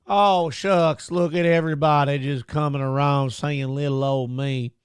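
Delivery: put-on folksy Southern drawl, aw-shucks; mock Southern accent. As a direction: play the harmless Southern good old boy, mildly hammed. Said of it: "anybody with that voice".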